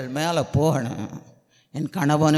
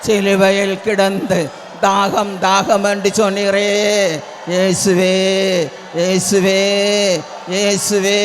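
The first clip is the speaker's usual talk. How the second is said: fervent pleading prayer; 5 semitones higher, 7 dB louder than usual. Real, not acted. Preacher crying to Jesus for a spirit of prayer, fervent pleading. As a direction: chant each plea insistently at full voice.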